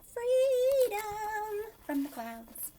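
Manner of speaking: high, loud whoop of freedom; gleeful cry. A narrator's reading. Raindrop leaping from the clouds cries out, high and gleeful. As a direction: fling the word out like leaping off a cliff. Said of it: "He's like".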